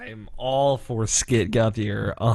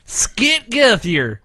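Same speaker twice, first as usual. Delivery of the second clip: Skit Guthier drawn out slowly and loud; drawn-out title name; 10 semitones higher, 6 dB louder, much slower than usual. Acted. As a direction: stretch "Skit Guthier" out like a grand announcement.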